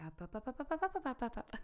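climbing, high-pitched string of 'up, up, up'; rapid wake-up call. Shown as a demonstration. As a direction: pop them out of trance with rising staccato. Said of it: "Until I say".

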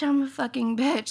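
usual speaking voice

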